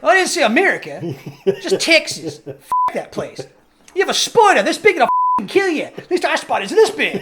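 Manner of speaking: loud, high, hammed-up Australian; bad Australian accent. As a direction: rant like an exasperated Australian, badly faked. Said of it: "my Australian accent was terrible".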